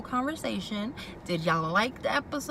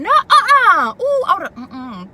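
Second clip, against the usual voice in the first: much higher and louder, outraged; shocked disbelief, almost shouting; outraged exclamation; 11 semitones higher, 16 dB louder, slower than usual. Real, not acted. Outraged reaction to the scene.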